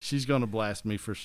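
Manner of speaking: normal talking voice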